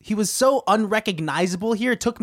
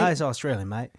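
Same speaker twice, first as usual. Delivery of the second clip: put-on lower, jokey Australian accent; 6 semitones lower, 4 dB quieter than usual. Host echoing the guest's slip, put-on Australian accent. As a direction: echo him in mock Australian. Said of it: "It's Australian".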